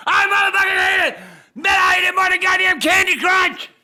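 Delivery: angry rant. Real, not acted. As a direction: rant it out at full volume. Ranting at the game.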